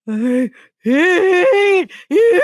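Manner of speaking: high, loud, drawn-out squeal; panicked wail. Retold or imitated. Teacher wetting herself at prankster coworker, panicked high wail. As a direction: wail it slowly while trying to clench and breathe. Acts out panicked reaction while clenching.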